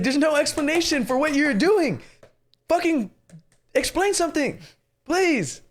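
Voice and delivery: high-pitched, exasperated rant. Real, not acted. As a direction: plead in disbelief for an explanation. Exasperated complaint about the movie.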